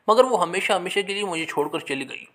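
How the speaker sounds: usual speaking voice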